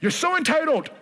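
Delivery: mock exasperation at a child, ugh and all; a hint of an annoyed parent scolding; exasperated parent. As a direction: parent venting at an entitled kid. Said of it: "You wanna say".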